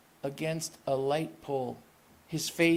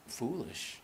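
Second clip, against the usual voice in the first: ordinary delivery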